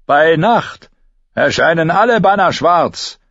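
high-pitched, imperious soldier's call; commanding knight. A narrator's reading. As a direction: declare it like an armed knight. Knight with spiked helm speaks.

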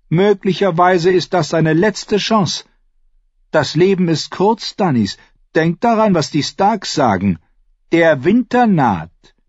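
lightly mocking lord, pitched high; Renly, teasing younger brother. A narrator's reading. (hohe, spöttisch-leichte Stimme)

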